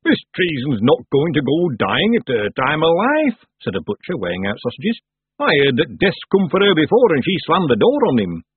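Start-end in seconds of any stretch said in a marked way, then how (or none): 0.0-3.5 loud, slow, higher character voice; disbelieving butcher. Acted. Butcher scoffs at news. 5.4-8.4 disbelieving butcher. Acted. Butcher tells rumour.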